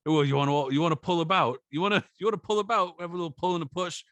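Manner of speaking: high, quick, goading challenge; hammed-up, high-pitched provocation; mock fighter's taunt. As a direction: imitate a boxer daring someone to scuffle. Acts out boxer's taunt before shoving.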